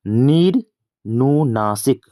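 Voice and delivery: "निर्नुनासिक" stretched slowly, piece by piece; slow dictation of "निर्नुनासिक" with pauses between parts; drawn out. Shown as a demonstration. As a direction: draw out each part of "निर्नुनासिक" for the class. Teacher spells out the answer slowly.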